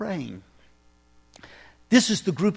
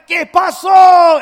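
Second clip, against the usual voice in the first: much higher, much louder, slow Spanish yell; hammed-up shout; 11 semitones higher, 14 dB louder, slower than usual. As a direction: belt it out to get the crowd laughing. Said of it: "And it was like".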